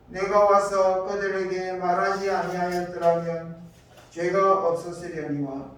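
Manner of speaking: normal talking voice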